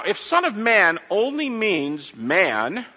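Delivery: ordinary delivery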